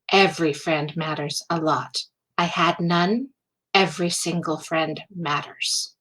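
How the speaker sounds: low, measured, earnest delivery; dead serious. Acted. To a new acquaintance, on why friends matter, dead serious. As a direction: say it low and grave, softening toward the end. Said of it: "dead serious".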